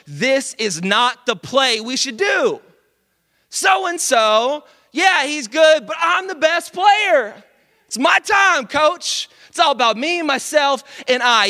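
high, loud, insistent and full of himself; demanding outburst. Retold or imitated. Pippen yelling at his coach in front of everyone, loud and demanding. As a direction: demand the ball like the star.

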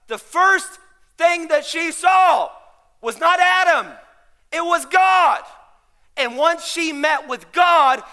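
slow, loud, high-pitched proclamation; full-force preaching. Real, not acted. Pastor telling ladies Eve met God first, preaching forcefully. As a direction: build in short, weighty phrases to a shout.

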